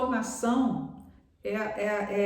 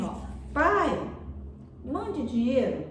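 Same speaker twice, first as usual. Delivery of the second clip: high, slow, pleading sweetness; sweetly imitated child's request; mother's tender reading; 4 semitones higher, slower than usual. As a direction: draw it out as a loving plea. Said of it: "a entonação de voz, a forma que ela leu".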